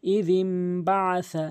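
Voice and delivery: slow drawn-out tajweed chant; slow measured recitation with nasal ghunnah; Quranic recitation. Acted. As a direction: recite each word long and melodic.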